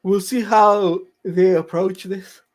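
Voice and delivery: words stretched by a yawn. Real, not acted. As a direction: talk through a big yawn. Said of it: "Sorry if I'm yawning".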